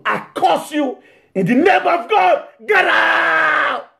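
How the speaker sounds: high, forceful curse shouted at full voice; commanding shout. Acted. Preacher casting out evil, booming shout. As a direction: cast the spirit out with a thundering command.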